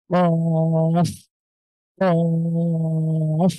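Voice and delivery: কফ with the tongue root pushed hard against the back, held long; Arabic qaf with a push; punched qaf. Shown as a demonstration. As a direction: thrust the tongue root and hold কফ. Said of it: "উপরের সাথে খুব ধাক্কা দেবো আমরা".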